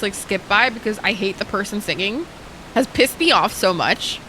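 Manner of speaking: ordinary talk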